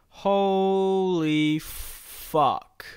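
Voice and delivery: very slow, drawn-out awe; long astonished exclamation; stunned amazement. Real, not acted. Amazed at the sight of castle.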